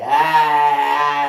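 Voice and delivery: slow, loud drawn "ah" at the end; slow, loud shouted ad-lib; loud ad-lib demo. Shown as a demonstration. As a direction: belt the ad-lib out slowly. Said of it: "je suis le mec qui a emprisonné".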